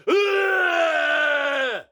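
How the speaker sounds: put-on loud, high drunken "Ugh!" shout; drunk grunt. Drunk guys with their drinks at the show, loud grunt. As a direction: bellow it like a drunk guy at a concert. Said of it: "the guys are like".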